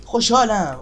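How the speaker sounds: happy exclaim. Real, not acted. Said again louder.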